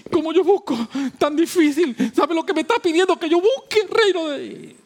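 high, fast complaining voice of the people; whining doubter. Retold or imitated. Preacher mimics people grumbling that seeking is hard, high whiny voice. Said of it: "La gente".

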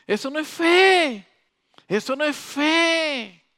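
grito alto y lento, suplicante desde el cielo; Dios gritando. Acted. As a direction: clama obedéceme, muy alto y alargado. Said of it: "Dios está gritando desde el cielo". (Dios grita desde el cielo a su pueblo, más alto y lento)

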